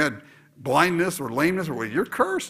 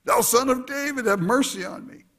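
crying out for mercy; 8 semitones higher than usual. Retold or imitated. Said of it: "he cried the more a great deal".

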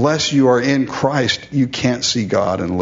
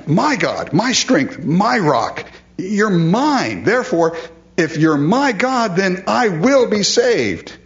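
fervent; 8 semitones higher than usual. Retold or imitated. Preacher voicing David's fervent declaration.

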